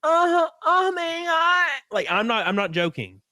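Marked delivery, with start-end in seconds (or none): 0.0-1.8 high, slow, drawn-out mock moan; parody moan. Parodying the moaning dude from a video, high, slow mock moan. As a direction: ham up the guy moaning on the video, high and stretched. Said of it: "in the same cadence that I'm making right now in my parody".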